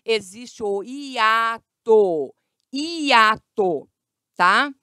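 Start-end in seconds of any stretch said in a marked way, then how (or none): 0.8-3.9 "hiato" loud and slow as i-a-to; separated syllables. Shown as a demonstration. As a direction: stretch each vowel of "hiato". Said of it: "elas ficam em sílabas separadas".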